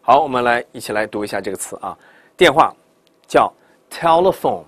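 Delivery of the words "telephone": telephone as TEL-luh-phone, dark L then clear L; slow American telephone, lingering on the L; American double L. Shown as a demonstration. Teacher reads the word for 电话, American double L. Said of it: "美音叫telephone".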